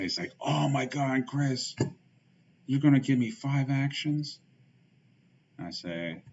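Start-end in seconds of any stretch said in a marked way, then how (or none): 0.4-4.4 higher, louder mock-excited outburst; imagined excited opponent. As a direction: act out an opponent's over-the-top excitement. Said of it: "He's gonna be so excited".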